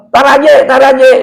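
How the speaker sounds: comic high-pitched calling out; imitated cry for help. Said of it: "teriak-teriak dari apa?".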